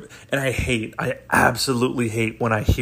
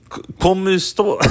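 halting, higher attempt at Russian-accented Spanish; 7 semitones higher than usual. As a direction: try Spanish with a mock Russian accent, faltering. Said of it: "speak with a Russian accent on top of it".

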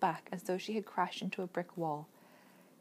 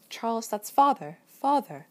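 loud, raging outburst at her brother; sick rage; 3 semitones higher, 8 dB louder than usual. A narrator's reading. Meg furious at Charles over their father. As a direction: shout it at him in fury, louder on the second father. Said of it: "Meg swung on him with sick rage".

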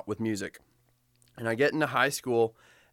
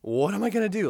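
freaking out; 5 semitones higher, 5 dB louder than usual. Retold or imitated. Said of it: "I'm literally freaking out".

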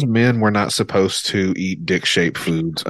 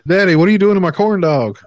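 put-on high, louder child voice; little kid voice; 10 semitones higher, 5 dB louder than usual. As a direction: play a whiny little kid. Acting out a child.

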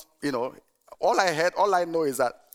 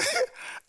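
high laugh; 4 dB quieter than usual. Real, not acted. Laughs recalling the story.

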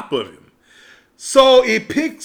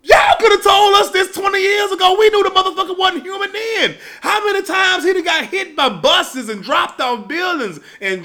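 outraged audience rant; 6 semitones higher than usual. Retold or imitated. The audience yelling at Jamie Lee Curtis on screen, outraged high shout. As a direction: holler at the screen like a fed-up viewer. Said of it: "So we sit up there like".

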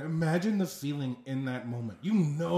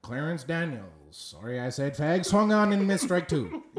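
baseball voice. Acted. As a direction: slip an apology into the call. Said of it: "think of the baseball voice".

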